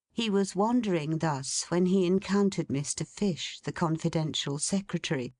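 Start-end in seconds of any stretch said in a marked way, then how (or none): none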